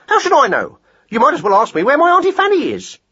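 high-pitched, impatient retort; exasperated hare. Acted. Hare fed up with mouse's question, exasperated.

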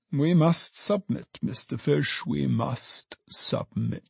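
slow, softening submission; fading, resigned sigh of piety; pious resignation. A narrator's reading. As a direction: let it sink slowly from high to hushed. Alderman piously resigns himself.

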